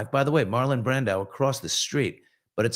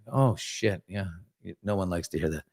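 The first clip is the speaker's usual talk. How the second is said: normal talking voice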